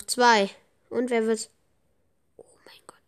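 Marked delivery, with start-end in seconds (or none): 2.4-3.0 hushed, breathless whisper of amazement; awed whisper. Real, not acted. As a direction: whisper it in awe. Awed at skilled player.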